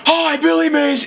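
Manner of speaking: sudden infomercial shout; Billy Mays yell. Retold or imitated. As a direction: bark the intro like a TV pitchman. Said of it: "He just goes".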